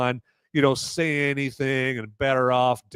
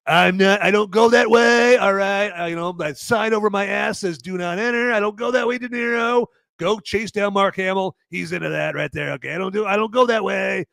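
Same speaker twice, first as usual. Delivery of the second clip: a bad, higher Trump voice; bad Trump impression; 6 semitones higher than usual. Streamer's self-admitted bad Trump turning down De Niro. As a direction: brush him off like Trump. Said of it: "my Trump impression sucks".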